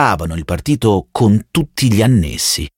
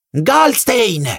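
very high savage shout of the name; beastly yell; 13 semitones higher than usual. A narrator's reading. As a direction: howl it like an animal. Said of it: "urlò il ragazzino come una bestia". (urlo bestiale e acutissimo)

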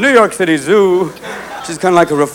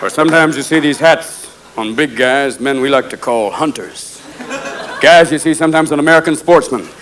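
normal talking voice

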